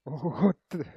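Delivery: startled, high and loud; surprised. Real, not acted. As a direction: caught off guard, voice jumping up. Phone does something unexpected.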